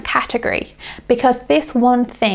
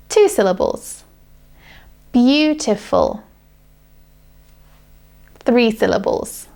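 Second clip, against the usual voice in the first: usual speaking voice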